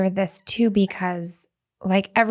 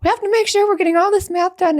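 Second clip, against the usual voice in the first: high, fast anxious rush; anxious urgency; 10 semitones higher, faster than usual. Retold or imitated. Mother pushing kids through math, high anxious rush. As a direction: hurry everyone with nervous urgency. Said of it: "I get so anxious".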